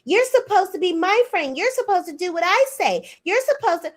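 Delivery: high whiny childish mimic of Tisha; mock her like a bratty twelve-year-old throwing a fit; whiny tantrum. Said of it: "throw a fucking tantrum like a twelve-year-old".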